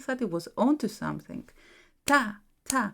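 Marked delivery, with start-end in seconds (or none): 2.1-2.8 two separate, equally weighted "ta" beats; even, detached "ta"; basic Greek beat. Shown as a demonstration. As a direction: punch out each "ta" alone with a gap. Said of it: "is the basic rhythm".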